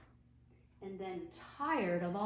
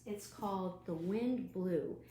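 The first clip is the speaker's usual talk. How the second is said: usual speaking voice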